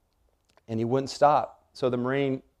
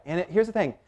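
ordinary delivery

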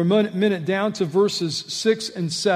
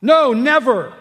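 all caps; 9 semitones higher, 9 dB louder than usual. Shown as a demonstration. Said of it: "it would be in all caps".

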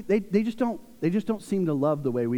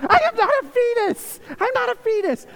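high-pitched, loud tantrum protest; hammed-up indignant squeal; outraged kid yell; 5 dB louder than usual. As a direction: imitate a furious little sister shrieking back. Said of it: "she gets so mad".

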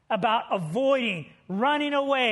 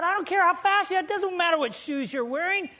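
exasperated Dwayne; 5 semitones higher, 4 dB louder than usual. Retold or imitated. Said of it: "He said".

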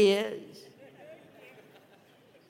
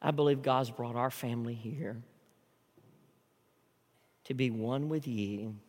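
much softer and lower; hushed sermon close; 9 semitones lower, 10 dB quieter than usual. Acted. Pastor closing to First Baptist, hushed. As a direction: bring it down to a whisper of sincerity.

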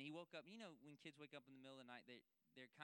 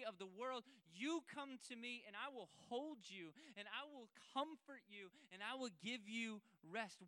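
fervent preaching; 8 semitones higher, 8 dB louder than usual. Acted. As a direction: plead with the congregation, building with each line. Preaching God's invitation at full force.

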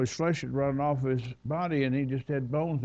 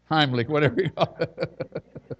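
loud start dissolving into a laugh; 4 semitones higher, 4 dB louder, slower than usual. Real, not acted. Preacher joking to congregation about a choking person, laughing. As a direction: say it breaking into laughter.

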